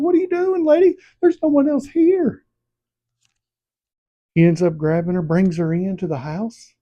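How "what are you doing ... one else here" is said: very high, loud mock holler; hammed-up desperate yell; imitated frantic calling. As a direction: call after a fleeing woman in a squeaky high voice. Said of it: "He's like".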